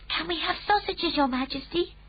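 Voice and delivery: put-on high, earnest child's voice; a small girl excusing her friend; little girl Sophie. A narrator's reading. Sophie asks.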